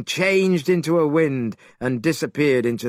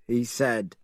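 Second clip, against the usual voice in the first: usual speaking voice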